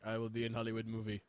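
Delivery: low, soft Russian accent imitation. Policeman agreeing to be in the movie, imitated with a Russian accent. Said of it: "I'm doing a Russian accent".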